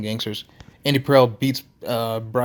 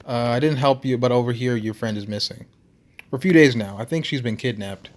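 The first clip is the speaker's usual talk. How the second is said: ordinary talk, nothing marked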